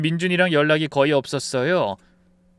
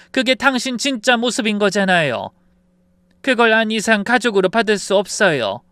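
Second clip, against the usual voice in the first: raised, firm voice of rejection; cold rebuke; 5 semitones higher, 5 dB louder than usual. Acted. Mother-in-law rejecting daughter-in-law as family, cold and forceful. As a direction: hand down a stern, cold verdict. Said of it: "전 차갑게 말했어요".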